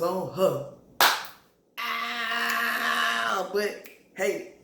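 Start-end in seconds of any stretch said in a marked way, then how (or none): 1.8-3.5 playful shout. Real, not acted. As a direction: call it out like you're egging them on. Reacting to the steamy scene.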